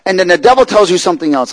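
loud, high preaching voice; forceful preaching. Real, not acted. Preacher warning the congregation about the devil, forceful preaching.

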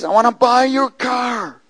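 imitating a high, drawn-out holler; slow, high call across a distance; retold yell. Big man yelling an offer into the woods, imitated yell. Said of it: "he starts yelling to me".

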